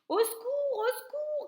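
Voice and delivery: high, loud desperate call; cry for help. A narrator's reading. Cook begging the kitchen for help, high loud cry. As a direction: scream for help. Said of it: "criait l'homme".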